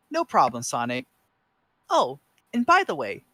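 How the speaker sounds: lightly voiced young fox; Tails voice.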